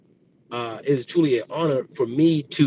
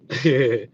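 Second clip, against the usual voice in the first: quick amused chuckle; short laugh; 3 semitones lower than usual. Real, not acted. Amused at his haters.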